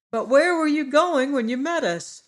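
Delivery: put-on high, loud boyish voice; young Tip questioning the bug. A narrator's reading. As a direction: ask it like a curious young boy. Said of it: "Tip asked the Wogglebug".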